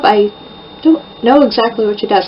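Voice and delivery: ordinary delivery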